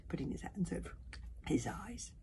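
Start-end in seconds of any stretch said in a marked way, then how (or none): none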